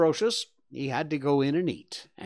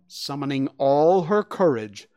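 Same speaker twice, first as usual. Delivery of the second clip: ordinary delivery, nothing marked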